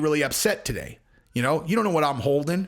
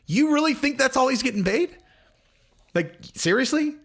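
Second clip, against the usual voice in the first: pitched way up in disbelief; you can't be serious, much higher; incredulous; 9 semitones higher than usual. Retold or imitated. To the video's claim of Conor's pay, much higher incredulous disbelief.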